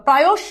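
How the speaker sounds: loud, rising প্রায়শ as "pra-yosh"; teacher models প্রায়শ with a full "o" before "sh"; careful pronunciation demo. Shown as a demonstration. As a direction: punch out প্রায়শ with its "yo" clear. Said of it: "উচ্চারণটা ভালো করে খেয়াল করো".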